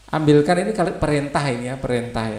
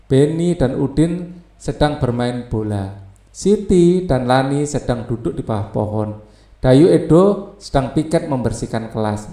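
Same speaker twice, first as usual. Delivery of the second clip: louder, steady reading-aloud voice for the class; textbook reading; 5 dB louder than usual. Acted. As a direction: read the textbook story out clearly to pupils. Reads textbook passage aloud.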